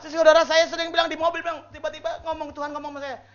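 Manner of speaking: ordinary talk, nothing marked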